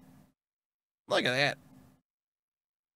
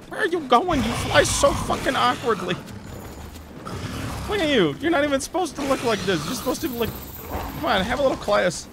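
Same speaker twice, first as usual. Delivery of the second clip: high, teasing put-down; mock the monster for flying badly; pitched-up scornful teasing; mocking scold; 6 semitones higher than usual. Real, not acted.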